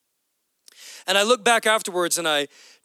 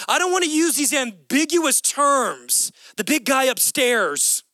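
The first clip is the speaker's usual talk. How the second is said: higher, louder, slow build into sung cadence; preaching at full force; 5 semitones higher, 3 dB louder, slower than usual. Acted. Preacher voicing God to the congregation, building to full-force chant. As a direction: rise into a chanted preaching climax.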